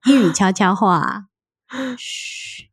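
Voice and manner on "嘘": breathy shush, no voice; whisper. Pretend. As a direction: hush like telling a secret. Mock whisper after naming show.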